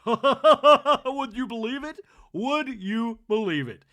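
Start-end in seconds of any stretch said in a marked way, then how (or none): 0.0-2.0 loud, high, laughing out the line. Real, not acted. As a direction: crack up mid question. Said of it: "repeated line".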